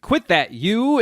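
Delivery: quick exasperated reprimand, played for laughs; mock scolding. Acting out Anasui scolding him.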